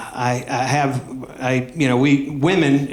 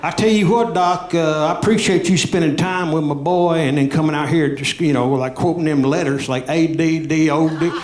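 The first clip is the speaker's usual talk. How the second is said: put-on Southern accent; 3 semitones higher than usual. Dad assuring the doctor about his boy, in a Southern accent. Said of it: "I'm giving him a Southern accent".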